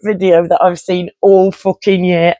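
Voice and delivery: laughing through the words. Real, not acted. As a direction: giggle while gushing about the video. Laughing about the video.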